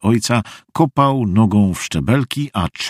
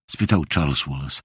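plain reading voice

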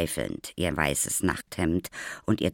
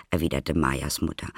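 usual speaking voice

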